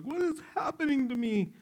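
high, startled cry of a pregnant woman; a mock panicked squeal; alarmed mother. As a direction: voice her shock at the kicking. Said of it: "the woman is like".

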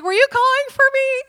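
put-on loud, very high child voice; oblivious little daughter. Daughter running out to searching parents, high and oblivious. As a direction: mimic a child calling out innocently, high and loud.

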